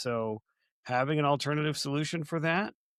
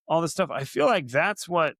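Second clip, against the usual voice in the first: normal talking voice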